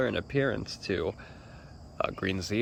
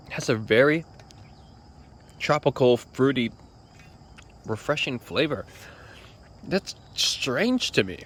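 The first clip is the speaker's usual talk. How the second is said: higher and louder in amazement; surprised; 5 semitones higher, 3 dB louder than usual. Real, not acted. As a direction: marvel at the unexpected taste. Surprised by the tropical taste.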